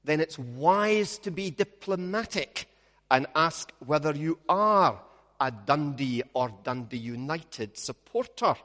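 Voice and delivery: usual speaking voice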